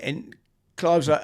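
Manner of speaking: usual speaking voice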